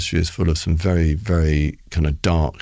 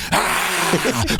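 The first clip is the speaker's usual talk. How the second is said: crowd roar; 12 semitones higher than usual. Retold or imitated. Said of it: "they all went".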